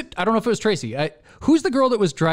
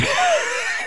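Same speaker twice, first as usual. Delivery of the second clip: a sudden high burst of laughter; nervous laugh mid-story. Real, not acted. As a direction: laugh it off, embarrassed.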